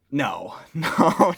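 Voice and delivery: ordinary talk, nothing marked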